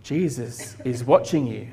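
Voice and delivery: a touch hushed and low, mysterious unseen voice; lightly voiced warning out of the dark. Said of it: "he heard this voice saying".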